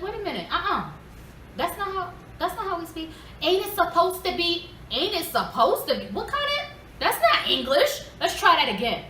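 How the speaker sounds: put-on high-pitched indignant correction of the kids; scolding mother. Mother retells correcting her kids' speech.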